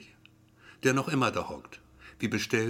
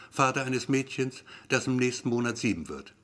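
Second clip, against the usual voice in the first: usual speaking voice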